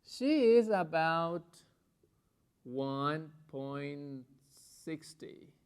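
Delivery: very slow, deliberate English; slow model sentence. Shown as a demonstration. Teacher building an example sentence, slow and deliberate. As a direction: model it word by word.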